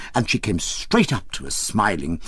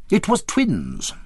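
slow, calm, measured; steady, unhurried and sure; calmly; 9 semitones higher, slower than usual. A narrator's reading. The narrator calmly tells the nurse, slow and composed. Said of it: "I said calmly".

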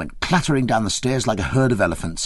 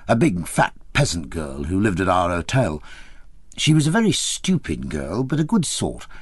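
plain reading voice

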